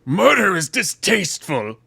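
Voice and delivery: slow, strained villain recital; mock villain voice. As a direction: intone it like a hammy villain. Mock villain reciting his haiku.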